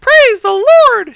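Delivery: put-on very high, loud, drawn-out old lady whoop; joyful cry. A narrator's reading. Old lady finding groceries on her porch, crying out high. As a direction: cry out praise at full volume. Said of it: "she cried".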